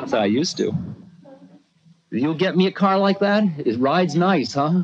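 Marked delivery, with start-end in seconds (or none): 2.1-4.7 guru's teasing voice. Retold or imitated. Guru coaxing the visitor for a car, high and teasing. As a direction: wheedle like a cheeky old man. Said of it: "he says".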